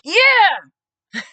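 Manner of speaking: imitated high, loud, long "yeah"; triumphant cheer. Supporter cheering as he signs the petition, triumphant. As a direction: whoop it like a cheering fan.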